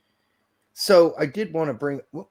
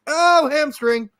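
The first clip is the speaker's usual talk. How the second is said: mock dismay; 13 semitones higher, 5 dB louder, much slower than usual. Mock player pulling up injured.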